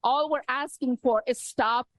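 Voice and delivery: usual speaking voice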